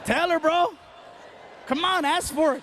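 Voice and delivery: pushy urging. Retold or imitated. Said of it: "and he goes".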